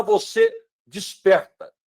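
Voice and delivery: ordinary talk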